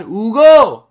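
loud high yell of the name Hugo; shouting. Shown as a demonstration. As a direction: holler Hugo, ending pushed. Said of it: "Mas se for para gritar".

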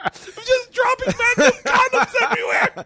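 very high, loud laughing shout; hysterical laughing riff. Acted. Comic riffing on the condom-dropper's habit, hysterical laughing shout. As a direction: shriek the joke through your laughter.

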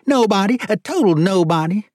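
high, loud character voice; emphatic high-voiced judge; serious judge. A narrator's reading. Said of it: "the judge replied seriously".

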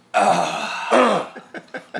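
a high strained grunting sigh at the urinal; pee grunt. Retold or imitated. Imitating a stranger's urinal grunt, high strained grunt. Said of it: "something that I'm gonna call the pee grunt".

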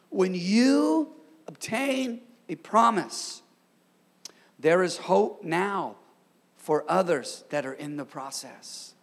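slow, word by word, leaning on "obtain"; slow emphatic repeat. Real, not acted. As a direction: draw out "When you" and pause between phrases. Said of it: "I'm gonna say it again".

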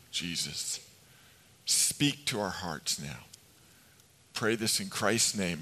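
soft, hushed pastoral prayer. Acted. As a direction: pray it humbly and quietly, settling the room. Opening prayer before sermon.

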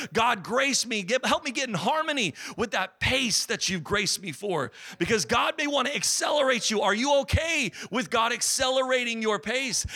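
high, driving sermon delivery; impassioned preaching. Real, not acted. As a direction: build urgency and press each phrase on the congregation. Preaches at rising intensity.

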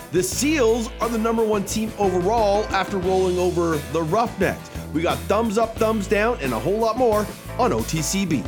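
upbeat promo read over music; announcer voice. Acted. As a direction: sell the show like a radio promo. Podcast intro announcer read.

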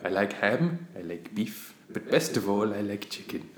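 low, soft talking-dog voice; lightly voiced pet dog, careful enunciation; dog's voice. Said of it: "like that".

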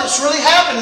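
ordinary delivery

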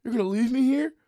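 high, loud mock plea; imitating abandoned drunk. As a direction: wail it like the drunk left behind. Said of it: "He's like".

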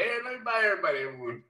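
high, fast tipsy cheer; drunk generosity. Retold or imitated. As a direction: shout the round like a happy drunk.

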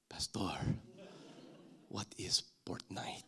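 hushed, near-whispered question; low and very soft, halting after "Pastor"; puzzled churchgoer. Acted. Churchgoer lost at the preacher's slang, whispering in puzzlement.

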